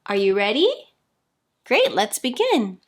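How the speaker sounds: ordinary delivery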